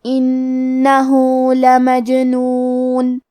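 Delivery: very slow, انہو with the final u drawn out two counts; slow recitation, long u on انہو; lengthened vowel demo. Shown as a demonstration. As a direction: stretch the u at the end of انہو. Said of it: "اپنی آواز دو مد کے برابر لمبی کرنا ہے".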